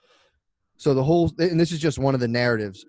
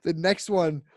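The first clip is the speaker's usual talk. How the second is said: laughing; 6 semitones higher than usual. Real, not acted. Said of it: "It's so good".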